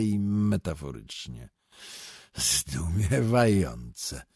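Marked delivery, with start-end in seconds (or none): 2.4-4.2 exclaiming in wonder. A narrator's reading. Stranger to the poet, slowly amazed. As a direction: draw the word out slowly in amazement. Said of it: "zawołał nieproszony dyskutant".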